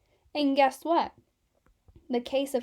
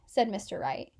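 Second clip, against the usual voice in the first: usual speaking voice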